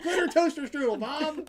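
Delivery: put-on child shouting the name to mom. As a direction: shout it like a thrilled little kid. Child voiced answering mom.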